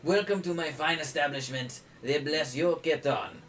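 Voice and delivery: put-on lightly French-accented halfling barkeep; a halfling innkeeper greets a guest in a mock French accent; butchered French accent. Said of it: "time for me to butcher my French accent".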